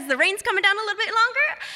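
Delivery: usual speaking voice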